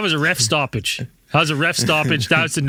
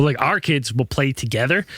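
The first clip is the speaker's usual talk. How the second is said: ordinary talk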